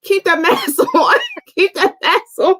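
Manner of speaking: laughing through the words. Real, not acted. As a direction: giggle while saying it. Laughing at Niecy in her mask.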